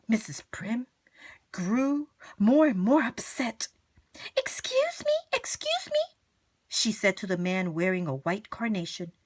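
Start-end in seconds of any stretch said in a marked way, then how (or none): 4.4-6.0 fretful little plea for attention; timid high voice. Acted. Mrs Prim searching.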